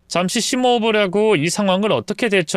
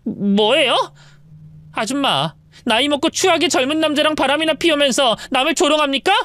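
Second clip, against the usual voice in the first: rising anger ending higher; angry wife; 11 semitones higher than usual. Acted. Wife threatens the mistress, growing angry. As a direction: build to a threat.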